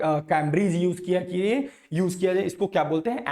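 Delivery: ordinary talk, nothing marked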